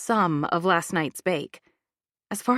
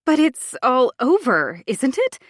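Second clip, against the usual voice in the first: higher, louder, insistent objection; alarmed protest; 5 semitones higher, 6 dB louder than usual. Acted. Gallery owner objects to the insurance review, alarmed and insistent. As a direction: pile up the reasons anxiously.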